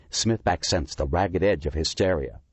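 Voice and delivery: ordinary talk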